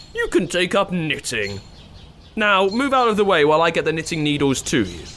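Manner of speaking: a keen helpful prince. Acted.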